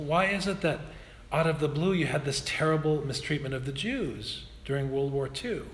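ordinary talk, nothing marked

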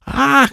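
short, very high scream. A narrator's reading. Girl attacked by jumping cards, high scream. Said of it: "Krista screamed".

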